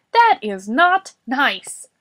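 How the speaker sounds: loud, slow, scolding roar; Boris. A narrator's reading. Boris scolding Morris for rudeness, roaring. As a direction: hammer out each word in reproof. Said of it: "Boris roared".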